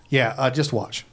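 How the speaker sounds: ordinary delivery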